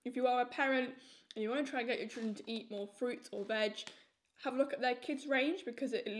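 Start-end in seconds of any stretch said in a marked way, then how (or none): none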